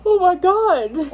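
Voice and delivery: loud reaction to an absurd choice; loud exclamation. Real, not acted. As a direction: exclaim it big and drawn out.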